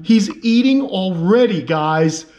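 real high-pitched, thrilled outburst; overjoyed and yelling with amazement; excited yelling. Real, not acted. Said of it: "I'm yelling. I get excited".

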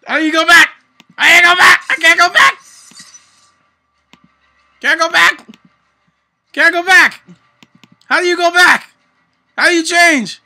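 high, loud frantic panic at the screen; panicked yelling. Real, not acted. Real panic, yelled high and loud at the game. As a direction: streamer losing it over how to go back.

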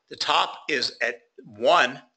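normal talking voice

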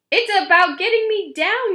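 high, loud shout from above; yelling. Acted. Uncle Ned stuck in the air, yelling down. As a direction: shout it down from the sky. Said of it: "yelled Uncle Ned".

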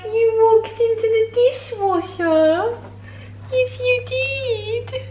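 high, sing-song pet voice; high-pitched doggy talk, drawn out. Real, not acted. Baby talk to dog.

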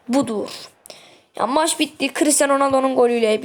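usual speaking voice

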